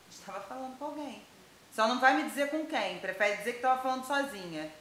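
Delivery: normal talking voice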